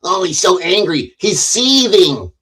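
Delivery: high, drawn-out mimicry of critics; mocking accusers. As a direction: imitate scandalized onlookers, stretching the last word. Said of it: "other people going".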